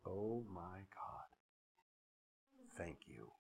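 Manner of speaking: hushed, low, reverent prayer; awed prayer. Real, not acted. Preacher turning to God in soft grateful awe. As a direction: breathe it out in quiet awe.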